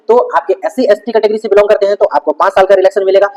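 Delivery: usual speaking voice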